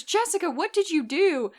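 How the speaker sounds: high, exasperated scolding of a student; an imitated teacher at the end of her rope; exasperated professor. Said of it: "she was kind of exasperated".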